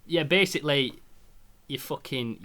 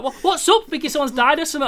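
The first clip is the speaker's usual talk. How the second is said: much higher, louder worried voice; imitating girlfriend; 13 semitones higher, 6 dB louder than usual. His girlfriend alarmed on the phone, high and worried. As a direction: play her alarmed. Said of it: "She's like".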